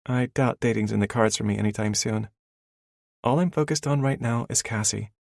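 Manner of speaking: female character voice. A narrator's reading. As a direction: laugh it off as the heroine. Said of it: "She laughs".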